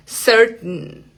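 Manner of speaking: "certain" caught in the throat at the T; glottal T. Shown as a demonstration. Teacher repeating the example for learners, glottal T. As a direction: end "certain" on a nasal n with no vowel. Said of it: "Esa es una T glotal".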